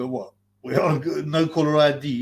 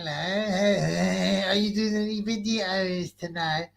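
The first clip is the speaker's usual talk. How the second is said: imitating caller; 5 semitones higher, slower than usual. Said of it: "He said".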